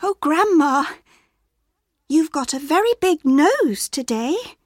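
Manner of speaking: lightly voiced small girl; girl's voice. A narrator's reading. Girl noticing grandma looks strange, lightly high.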